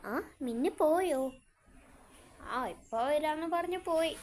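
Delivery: ordinary talk, nothing marked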